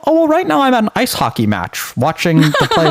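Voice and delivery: high, breezy put-on chatbot voice; airy mock reply from a forgetful chatbot. Chatbot now claims to be at a hockey match, in a high mock voice. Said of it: "And it would say".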